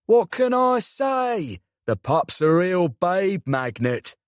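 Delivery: slow, cheeky South London drawl; smug uncle with a London accent. A narrator's reading. Cheeky uncle voiced in story.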